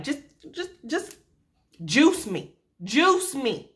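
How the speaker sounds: high, pleading and desperate; desperate plea. Retold or imitated. Woman in labor begging staff for anesthesia, desperate plea. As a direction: beg for the drugs.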